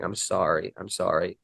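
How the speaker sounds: soft, choked-up repeated sorry; quiet, broken apology, near tears; retelling a tearful sorry, softly. Retold or imitated. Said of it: "So I immediately started crying".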